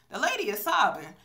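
ordinary talk

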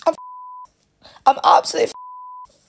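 on the edge of breaking down, high; distraught. Real, not acted. Parent worn out by money worries, distraught.

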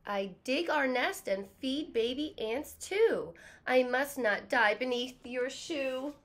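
loud, slow, earnest plea; ant voice. A narrator's reading. Teacher voicing the ant begging the kid, loud and slow. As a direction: plead for your life, slowly. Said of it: "Now the ant is speaking".

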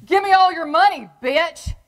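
loud, high thug bark; robber's demand. Retold or imitated. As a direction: shout it like a punk kid with a gun. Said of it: "and says to me".

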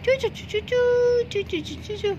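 very high chirping; bird chirps. Acted. Little bird calls Barbie for help, very high chirps.

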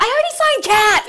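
put-on high, loud whiny toddler protest; a three-year-old who's had enough. Toddler son whines at mom on set, high and loud.

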